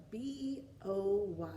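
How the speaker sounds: slow letter-by-letter spelling; spelled out letter by letter. Shown as a demonstration. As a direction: spell each letter clearly for kids. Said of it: "let's spell it".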